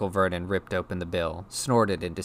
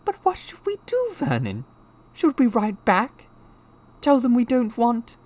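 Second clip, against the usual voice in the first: high, worried wife's voice; anxious Aunt Petunia; 7 dB louder than usual. A narrator's reading. Wife asking husband about replying, high anxious voice. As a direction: ask fretfully, pitched very high.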